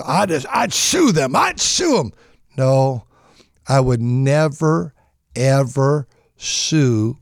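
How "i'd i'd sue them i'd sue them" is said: a lightly voiced whiny sibling, higher; mock greedy heir. A hint of a spiteful heir threatening family. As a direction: sneer it like a petty sibling. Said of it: "they ousted".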